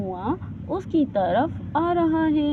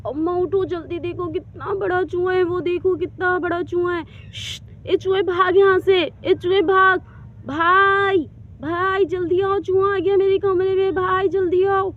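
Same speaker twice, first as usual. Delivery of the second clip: loud, higher frightened calls; scared shouting; 5 semitones higher, 7 dB louder than usual. Acted. Rani shouts for her mother and brother, loud, higher and scared. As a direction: yell at the mouse and call for help.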